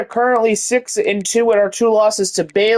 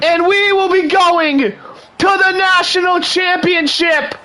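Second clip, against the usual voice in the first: ecstatic shout; 8 semitones higher than usual. Real, not acted. Game-winning interception call.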